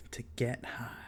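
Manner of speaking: slow, whispered; dramatic whisper. Acted. Telling viewers it was time to smoke, slow whisper. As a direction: hush it like a secret.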